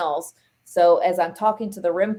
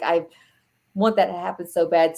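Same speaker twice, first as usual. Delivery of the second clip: ordinary delivery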